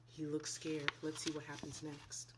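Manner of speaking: ordinary delivery, nothing marked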